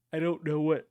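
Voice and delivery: talking through a yawn; words stretched by a yawn. Real, not acted. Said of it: "I apologize for yawning".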